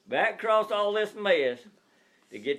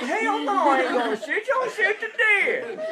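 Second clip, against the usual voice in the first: laughing retort; 4 dB louder than usual. Retold or imitated. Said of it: "I said".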